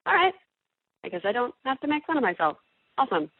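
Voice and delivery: high, quick relieved joy, settling down at the end; relieved delight. Retold or imitated. Voice actor relieved that fans liked her accent, high and quick. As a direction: sound thrilled you're off the hook. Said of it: "And I was like".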